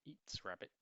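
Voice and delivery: plain reading voice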